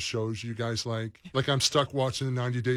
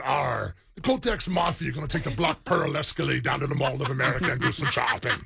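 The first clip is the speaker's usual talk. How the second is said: put-on pirate accent; 2 semitones higher, 4 dB louder than usual. Teasing his wife's friends in a pirate accent. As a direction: growl it like a swaggering pirate captain. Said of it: "do my pirate accent".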